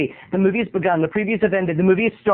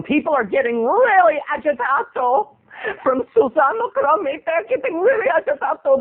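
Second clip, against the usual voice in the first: higher, hammed-up agitato; mock Italian flourish; 7 semitones higher than usual. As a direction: ham up agitato like a diva. Mock dramatic comedic word.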